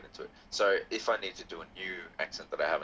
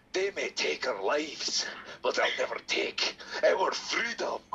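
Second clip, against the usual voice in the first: slow, higher rousing Scottish accent; rally the lads in broad Scottish, building to a battle cry; 6 semitones higher, slower than usual. Shown as a demonstration. Host showing off a Scottish accent as a stirring speech. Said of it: "let's just do Scottish".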